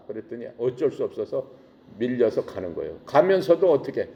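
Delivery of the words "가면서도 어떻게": normal talking voice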